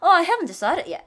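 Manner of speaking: exaggerated regret. Shown as a demonstration. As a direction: overplay disappointment. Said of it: "이것보다". (과장해서 아쉬워하며)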